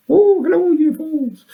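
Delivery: put-on a high cooing hello to the year fours, as if to small children; squeaky excited hello. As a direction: pitch way up and coo at the year fours. Greets year four listeners.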